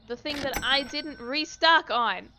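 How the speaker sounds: loud frustrated outburst; loud indignant exclamation; exasperated shout. Real, not acted. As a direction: yell it in frustration.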